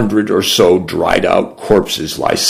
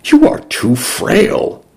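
put-on girl's voice; 13 semitones higher, slower than usual. A narrator's reading. Girl voiced in the story.

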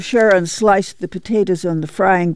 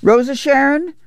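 higher, rising call; 9 semitones higher than usual. A narrator's reading. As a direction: call out to summon the girl. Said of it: "Ma called".

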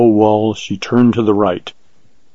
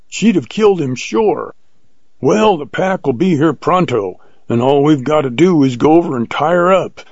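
high-pitched, animated character voice; jubilant old hunter; 6 semitones higher than usual. A narrator's reading. Hunter praises companion for saving the dog, jubilant. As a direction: pitch it up, relieved and triumphant. Jones jubilant after the hound's rescue.

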